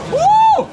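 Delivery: loud high whoop; excited whoop. Real, not acted. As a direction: whoop out in triumph. Cheering after a hard climb.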